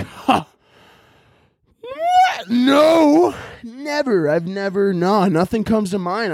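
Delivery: squeaky high shocked yelp then feigned innocence; mock innocent denial. Himself lying to the sponsor rep, hammed-up shock. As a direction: play dumb, startled.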